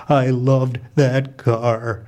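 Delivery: slow, low grieving mom voice; crushed. Acted. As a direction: grieve for the car, dragging out each word. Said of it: "My mom is clearly crushed".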